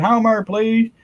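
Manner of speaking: high, lightly voiced scold; a hint of a nagging wife; mock Marge voice. Viewer's quote read as Marge scolding Homer. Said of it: "Anthony said".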